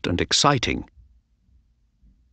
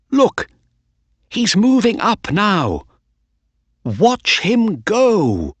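excited; 12 semitones higher, 3 dB louder than usual. A narrator's reading. Narrator cheering the race.

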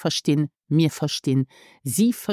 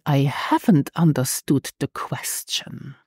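whisper. Shown as a demonstration. Prompt whispered.

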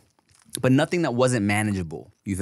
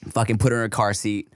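usual speaking voice

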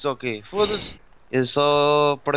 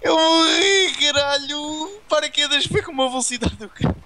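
frustrated outburst; 10 semitones higher, slower than usual. Real, not acted. Dies in game, swears.

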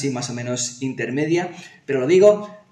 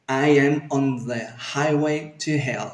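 slow, evenly stretched English phrase; slow motion; slower than usual. Shown as a demonstration. Teacher models for learners to follow, slow motion. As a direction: take it slowly, intermediate pronunciation. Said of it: "vamos en slow motion".

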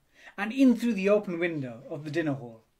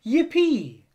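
high, loud whoop; excited cry; 10 semitones higher, 10 dB louder than usual. A narrator's reading. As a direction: cheer it out with delight. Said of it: "they cried".

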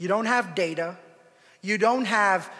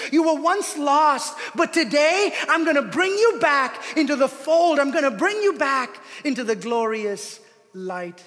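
promising Jesus voice; 8 semitones higher, 8 dB louder than usual. Acted. Map voiced as Jesus speaking.

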